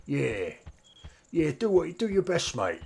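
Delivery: a cheery Cockney shopkeeper hint. Retold or imitated. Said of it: "he speaks Cockney in English".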